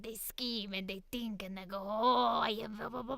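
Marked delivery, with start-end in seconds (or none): 1.8-3.2 gremlin voice. Retold or imitated. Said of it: "Little gremlin voices".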